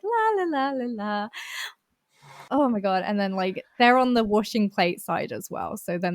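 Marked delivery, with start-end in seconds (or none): 0.0-1.3 mocking high sing-song, dropping at the end; airy, high mock humming; oblivious teammate. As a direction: mimic someone clueless, la-la-ing along. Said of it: "they're just there like".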